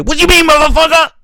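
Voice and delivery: high, loud screamed threats; mock rage. Mocking a rapper threatening a rival over chart results, loud high scream.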